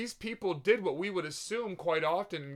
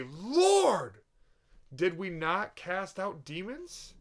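high loud pleading "Lord", then slow protest; desperate loud plea; pleading cry; 5 semitones higher, 6 dB louder, much slower than usual. Acted. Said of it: "Many are gonna say in the day".

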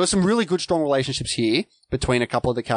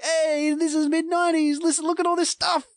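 high-pitched hammed-up show-off; showy mock excitement; 13 semitones higher than usual. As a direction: play an over-eager film flaunting its references, way up high. Mimics an over-eager film.

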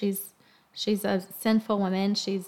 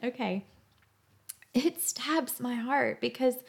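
close to tears; 2 semitones higher than usual. Real, not acted. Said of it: "I'm gonna cry again".

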